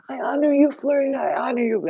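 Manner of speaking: high, loud devotee voice; acted adoring follower. Followers kneeling to honor her, high mock adoration.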